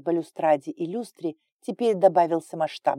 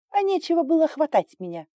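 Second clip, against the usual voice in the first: high-pitched angry outburst; angry retort; 11 semitones higher, 4 dB louder than usual. A narrator's reading. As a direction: lash out in a raised, much higher voice. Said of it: "рявкнула Инга". (сердитый выкрик высоким голосом)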